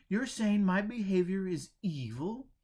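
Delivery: "Evil?" jumps up high and loud in disbelief; an outraged, startled echo of the word; shocked protest. Acted. Henry protests to Joe in loud disbelief. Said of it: "Henry protested".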